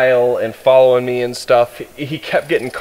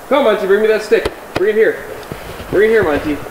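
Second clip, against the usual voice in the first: calling the dog; 7 semitones higher, faster than usual. Real, not acted.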